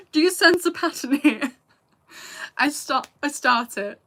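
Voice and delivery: loud, amused self-mockery. Real, not acted. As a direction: poke fun at your own bad habit. Laughs at own habit of stopping.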